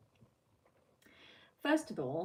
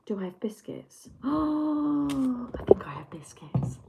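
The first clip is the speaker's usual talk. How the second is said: gasping delight; 6 semitones higher than usual. Pretend. Mock gasping excitement about biscuits.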